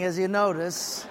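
ordinary delivery

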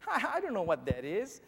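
put-on high-pitched, amused child; giggly little girl. A narrator's reading. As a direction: bubble up like a tickled small girl. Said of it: "tinkling of laughter just burst out".

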